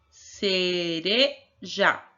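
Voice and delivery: "cereja" drawn out as ce, re... ja; long syllables, the last one set apart in "cereja"; slow syllable-by-syllable. Shown as a demonstration. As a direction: repeat "cereja" very slowly for a learner, syllable by syllable. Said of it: "vamos falar devagarinho".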